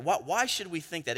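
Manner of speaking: usual speaking voice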